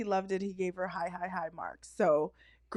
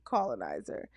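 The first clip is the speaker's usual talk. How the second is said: colonizer said much lower, deadpan; dropped low; 12 semitones lower, 5 dB quieter than usual. Shown as a demonstration. Teasing the Australian co-host, low deadpan colonizer. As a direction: drop the pitch way down on colonizer. Repeating the colonizer joke, deeper.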